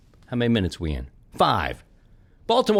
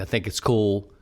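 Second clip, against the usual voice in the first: normal talking voice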